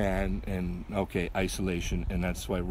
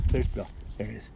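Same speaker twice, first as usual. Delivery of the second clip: ordinary delivery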